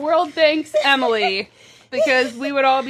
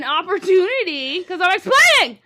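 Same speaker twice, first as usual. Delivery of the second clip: loud explaining voice; 5 semitones higher than usual. Real, not acted. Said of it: "This is my explaining voice".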